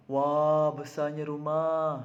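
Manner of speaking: loud, drawn-out "wah"; stretched-out awed admiration; amazed exclamation. Shown as a demonstration. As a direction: marvel slowly at the sight. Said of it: "perkataan wah tu adalah tanda takjub".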